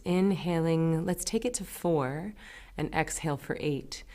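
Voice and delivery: soft meditation-teacher voice, with long pauses; guided breathing. Acted. Teacher leading listeners through inhale and exhale, guided breathing. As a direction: gently count them through each breath, leaving room to breathe.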